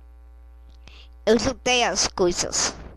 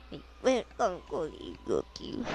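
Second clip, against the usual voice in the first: coaxing softly; 6 semitones lower, 14 dB quieter than usual. Acted. Chamando o gato baixinho.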